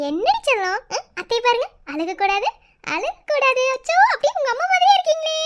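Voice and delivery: high sing-song coo, climbing at the end; cooing baby talk. Acted. Aunt greets crying newborn, high cooing baby talk. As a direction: fuss over the baby in baby talk.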